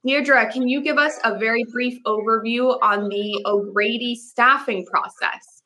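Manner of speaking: usual speaking voice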